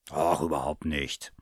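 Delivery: low, easygoing husband's voice; reassuring husband. A narrator's reading. Husband reassures his wife.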